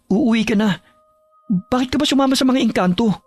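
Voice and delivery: high-pitched sibling voice; earnest younger sibling. Acted. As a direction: explain eagerly to your older sister, pitched high. Younger sibling explains to sister. (nakababatang kapatid na nagpapaliwanag, mataas ang boses)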